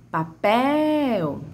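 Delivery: "Papel" with "pel" drawn out long; "Papel" called with long stressed "pel"; stretched final syllable. Shown as a demonstration. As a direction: hold the last syllable of "Papel". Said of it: "A sílaba que mais se estende".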